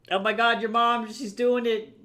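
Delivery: cheering someone on, thrilled; excited cheer. Real, not acted. Excited cheering at chat message.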